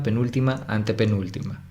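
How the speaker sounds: ordinary talk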